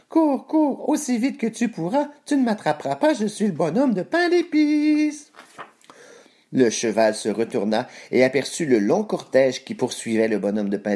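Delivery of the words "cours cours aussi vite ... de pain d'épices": very high, squeaky gingerbread man sing-song; taunting cry. A narrator's reading. Gingerbread man cries over his shoulder to the horse, high taunt. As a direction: call back teasingly in a tiny high voice. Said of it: "cria par-dessus son épaule".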